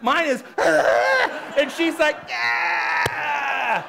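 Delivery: high, loud, laughing yeah; giddy shouted yeah; laughing whoop. Retold or imitated. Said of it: "I'm like".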